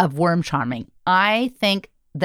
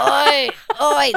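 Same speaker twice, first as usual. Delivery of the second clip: laughing horse grunts, a little higher; 7 semitones higher than usual. Retold or imitated. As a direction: huff like a horse lugging riders uphill. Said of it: "the horse was just like".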